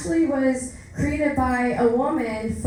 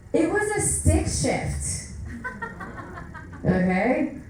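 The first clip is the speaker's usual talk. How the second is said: ordinary delivery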